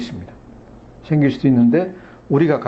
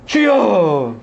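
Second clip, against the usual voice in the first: long, high call that slides down; shouting louder; 5 semitones higher, 4 dB louder, much slower than usual. Acted. Desperate blind man to Jesus, shouting louder. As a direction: cry out to be heard over the crowd. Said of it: "소리를 더 지르는 거예요".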